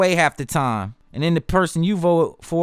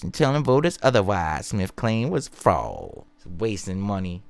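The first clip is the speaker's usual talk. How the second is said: lower, measured reading of a headline story; news article read; 4 semitones lower than usual. Acted. Reads news article aloud.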